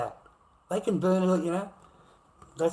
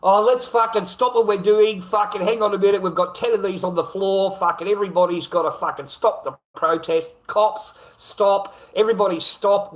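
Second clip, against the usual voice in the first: loud, higher sneering mimicry of the crowd; loud mock protester voice, higher than his own; 5 semitones higher, 8 dB louder than usual. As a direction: ham up their demands to ridicule them. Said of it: "they want the whole joint to stop".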